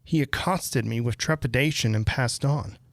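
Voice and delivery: ordinary delivery, nothing marked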